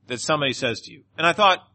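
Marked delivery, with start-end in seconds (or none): none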